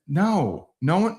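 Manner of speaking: normal talking voice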